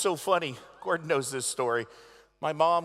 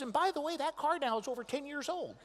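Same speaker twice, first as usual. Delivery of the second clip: mock mom voice; 11 semitones higher than usual. Mother voiced in story.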